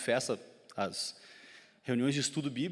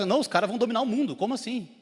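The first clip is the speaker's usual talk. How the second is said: fast, high-pitched incredulous quote; mock alarmed disbelief; 8 semitones higher, 3 dB louder, faster than usual. Townsmen reacting to the newly arrived preachers, high and incredulous. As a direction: imitate someone rattled and baffled.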